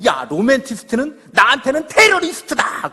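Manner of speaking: loud, high, acted teenage outburst; hammed-up indignant shout; angry retort. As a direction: yell back at your friends in exasperation. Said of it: "화가 나가지고".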